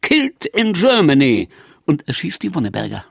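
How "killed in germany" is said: mild mock yell.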